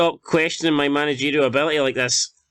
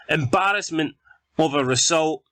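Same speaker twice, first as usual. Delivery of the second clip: usual speaking voice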